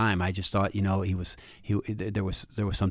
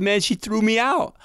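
crying; 11 semitones higher than usual. Retold or imitated. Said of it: "by this time he's crying".

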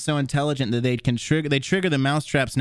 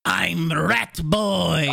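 slow, high squeaky rat voice; Rat Boy voice; 4 semitones higher, much slower than usual. Retold or imitated. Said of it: "your video on YouTube where it opens up with".

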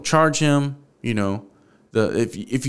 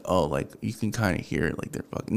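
subtle hint; 5 semitones lower, 9 dB quieter, faster than usual. Retold or imitated. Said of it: "like, a subtle, like, hint".

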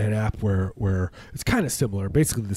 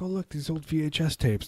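grandchild voice; 7 semitones higher, 3 dB quieter than usual. Retold or imitated. Imitating grandchildren.

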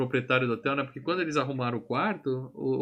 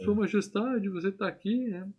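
mock surprised greeting; 8 semitones higher than usual. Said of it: "Arsenio greets the king".

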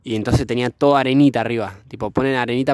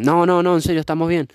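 higher, louder quick refusal; imitated wary girl; 6 semitones higher, 4 dB louder than usual. Scared girl insisting they are fine, imitated wariness. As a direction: lightly mimic a nervous girl insisting. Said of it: "porque tenían miedo".

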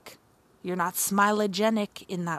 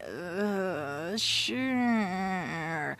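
reluctant uh sure; 4 dB quieter than usual. Retold or imitated. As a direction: grudgingly accept the offer. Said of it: "What's your reaction?".

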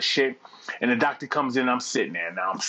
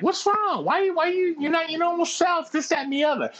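mock girlish concern, pitched way up; high girl voice; 13 semitones higher than usual. Date asking what's wrong in a high girlish voice. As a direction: play the worried date, high and sing-song.

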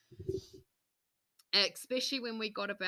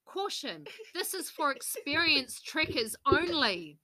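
sign reading; 5 semitones higher, 5 dB louder, slower than usual. Retold or imitated. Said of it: "it said".

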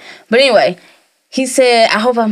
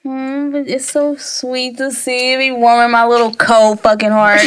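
usual speaking voice

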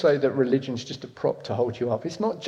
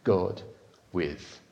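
softly fading; 7 semitones lower, 11 dB quieter, much slower than usual. Real, not acted. Closing words fading.

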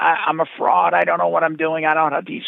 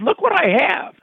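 excited reveal; 11 semitones higher than usual. Retold or imitated. Shows package to woman.

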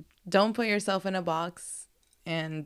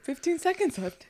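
coach voice; 10 semitones higher than usual. Retold or imitated. Imitating workout coach countdown.

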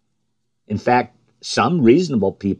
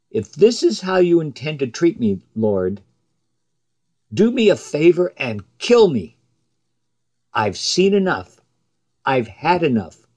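raised pitch, fed-up protest to God; exasperated plea; 5 semitones higher than usual. Acted. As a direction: plead like a leader who has had enough. Preacher voicing Moses pleading with God.

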